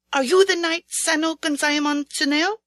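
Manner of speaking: put-on young general addressing a kneeling knight, formal. A narrator's reading.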